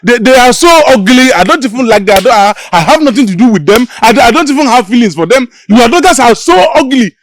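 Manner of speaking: accused servant shouting denials at the chief, frantic; panicked shouting. Acted. Panicked blurting insults to chief.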